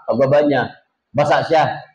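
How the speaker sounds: ordinary delivery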